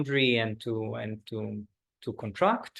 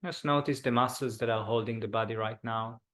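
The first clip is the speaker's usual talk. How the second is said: guided meditation. Acted. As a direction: ease the group into stillness. Leads a body scan meditation.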